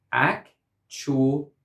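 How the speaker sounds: "actual" broken into ac, tu, al; three syllables. Shown as a demonstration. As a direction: sound out "actual" in three separate beats. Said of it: "This word has three syllables".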